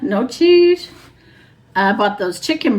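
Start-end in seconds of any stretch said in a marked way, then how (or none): 0.0-0.9 high, playful no cheese; playful squeak. Acted. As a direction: squeak it out teasingly. Joking contrast with cheese theme.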